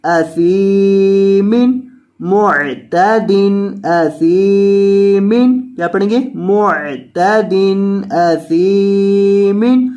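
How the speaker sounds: drawn-out Quran reading of each word; stretched tajweed recitation; slow recitation. Shown as a demonstration. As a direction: recite slowly and clearly for the student. Teacher recites Quranic words.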